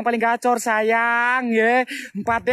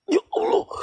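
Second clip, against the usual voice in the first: scared gasp; 13 semitones higher than usual. Real, not acted. Scared in horror game.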